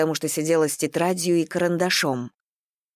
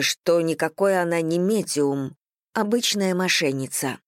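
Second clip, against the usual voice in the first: usual speaking voice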